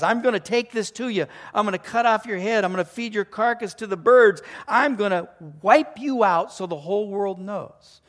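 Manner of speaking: loud, high, driving vows; David's bold threat. Acted. Preacher reads David promising Goliath's defeat, loud defiance. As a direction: pile up threats with mounting conviction. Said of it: "he says".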